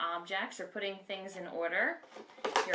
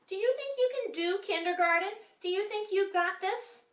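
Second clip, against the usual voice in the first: excited; 12 semitones higher, 6 dB louder than usual. Acted. Teacher cheering on kindergartners.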